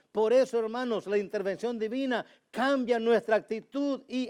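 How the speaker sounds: loud, high, fervent preaching; full-force preaching. Acted. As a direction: drive it home from the pulpit, loud and high.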